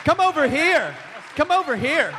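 calling out. Real, not acted. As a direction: beckon her across the stage. Calling guest over.

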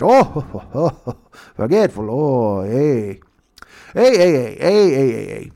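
laughing hammy Canadians; mock Canadian chatter. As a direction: ham up two chuckling Canadians.

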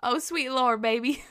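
playful mock Southern uncle; Southern accent. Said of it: "in his southern accent".

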